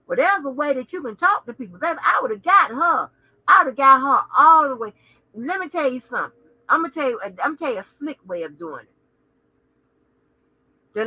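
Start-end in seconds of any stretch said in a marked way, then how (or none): none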